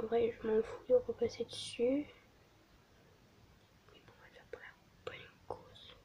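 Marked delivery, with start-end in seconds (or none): 3.9-6.0 barely audible fast whisper. Real, not acted. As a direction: breathe it out quickly. (chuchoté)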